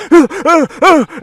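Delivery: high, loud panicked yelps; shrieking panic at a camera flash; panicked cries. Retold or imitated. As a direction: yelp in sudden fright. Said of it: "he starts to panic".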